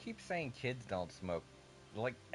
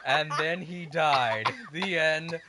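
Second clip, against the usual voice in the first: laughing; 3 semitones higher, 10 dB louder, much slower than usual. Real, not acted. Mock ending, laughing.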